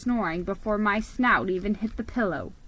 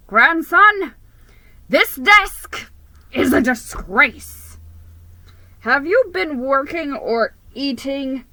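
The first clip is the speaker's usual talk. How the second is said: loud, high booming scold; thundering grandfather; 4 semitones higher, 8 dB louder than usual. Acted. Grandfather scolding his grandson over the messy desk, thundering. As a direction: thunder at him like a furious old boss. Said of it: "he thundered".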